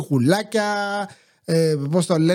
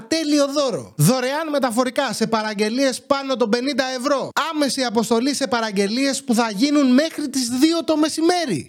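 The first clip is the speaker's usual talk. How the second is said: upbeat sponsor pitch, a bit higher; ad read; 4 semitones higher than usual. Acted. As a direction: sell the holiday gift shop to viewers. (ζωηρή διαφημιστική ανάγνωση)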